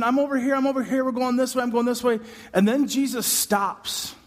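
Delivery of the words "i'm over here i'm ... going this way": higher, fast calling over a crowd. Retold or imitated. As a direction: wave him over, fast and urgent. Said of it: "Jairus is trying to get Jesus' attention".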